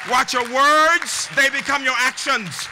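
loud, high, ringing orator's charge; rousing commencement exhortation. Real, not acted. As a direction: build it like a preacher, stretching the key words. Rousing close of commencement speech.

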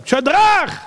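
high, drawn-out yell of names; calling out shout. Acted. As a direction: holler to people inside to come out. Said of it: "הוא התחיל לצעוק להם".

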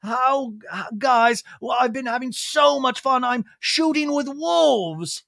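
high, drawn-out theatrical delight; gushing British restaurateur impression. Lisa taking a call from the guys, gushing impression. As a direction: greet the guys in a high put-on gush. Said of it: "we get a FaceTime call".

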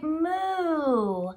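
long, drawn cow moo. Shown as a demonstration. Teacher answers what a cow says, mooing. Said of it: "what sound does a cow make".

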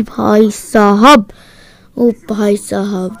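slow, stretched words of amazement; drawn-out exclamation. Real, not acted. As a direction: drag out each word in awe. Gamer marvels after kills. (खींच-खींचकर, हैरानी से)

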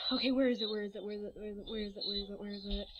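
low, soft repetitive mumble; muttering to self. Real, not acted. Vlogger hunting for the next animal, low muttering. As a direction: mutter it over and over under your breath.

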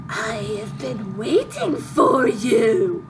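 slow, drawn-out creepy villain; throaty menace, ending in an evil laugh. Acted. Assassin greeting the hero who hunts him, creepy voice. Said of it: "I'm using my creepy voice for this".

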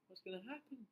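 usual speaking voice

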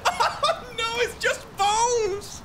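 high, drawn-out tearful laughing wail; mock wailing. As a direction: wail in playful grief. Mock grief over Larry's bones.

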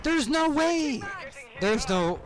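very high and loud indignation; furious complaint. Real, not acted. Gamer complaining the same gun outshot him, loud and high. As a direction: shout it like it's unfair.

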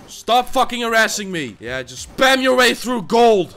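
loud, high, combative yelling; angry goading. Real, not acted. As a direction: dare the opponent, building to a shout. Goading opponent angrily.

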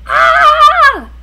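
high, loud frightened shriek. A narrator's reading. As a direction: shriek in terror. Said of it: "Stanley shrieked".

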